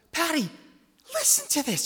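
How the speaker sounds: high and loud; excited urging. Retold or imitated. Husband pressing his wife, high, loud and eager. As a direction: plead with her to listen.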